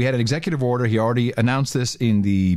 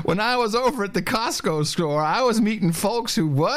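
higher-pitched mock politician voice; mocking impression; 8 semitones higher than usual. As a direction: parrot the speech back in a high, mocking voice. Mimics the played speech.

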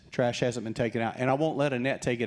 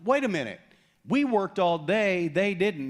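indignant complaint; 7 semitones higher, 4 dB louder than usual. Retold or imitated. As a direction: complain like the brothers who worked all day.

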